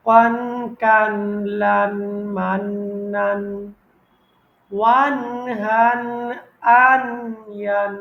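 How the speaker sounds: loud clipped ওয়ান, কান, লান with nasal ending; কান লান মান said short and strong; correct recitation. Shown as a demonstration. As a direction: recite each ending like tilawat. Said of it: "এটাই হচ্ছে সঠিক উচ্চারণ".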